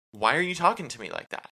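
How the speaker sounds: snappy escalation. Pretend. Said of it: "Versus her being like".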